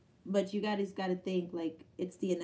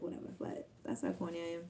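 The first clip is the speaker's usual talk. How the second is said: usual speaking voice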